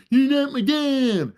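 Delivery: put-on high, slow defiant kid shout; bratty kid line. A kid yelling at the new stepdad, high and defiant. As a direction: whine it like a sulky stepkid. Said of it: "you're gonna hear the".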